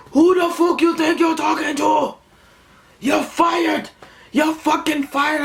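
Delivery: very high-pitched hollering caricature; mocking angry boss. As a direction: mimic a boss squawking in outrage. Acts out wannabe boss firing him.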